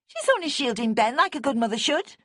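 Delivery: high, loud outburst; bursting out. A narrator's reading. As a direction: blurt it out high. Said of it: "Bonnie burst out".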